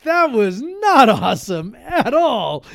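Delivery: high, slow, laughing protest; mock grumpy old man, laughing; grumpy retort. Dad answering his kids, high, slow and laughing. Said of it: "And I'm like".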